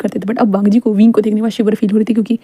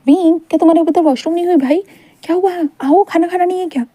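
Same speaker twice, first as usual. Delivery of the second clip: higher call through the door; calling from outside; 7 semitones higher than usual. Acted. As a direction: call him to dinner from outside.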